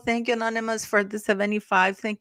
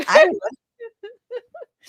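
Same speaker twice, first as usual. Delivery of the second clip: much higher and louder, a squealing cry; mock wail; 14 semitones higher, 6 dB louder than usual. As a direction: squeal it like a playful fake sob. Joking about getting mad at kids.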